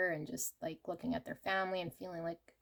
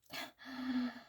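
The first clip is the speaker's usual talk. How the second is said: gasp; 5 semitones higher, 5 dB quieter than usual. Real, not acted. Reacting to tarot cards drawn.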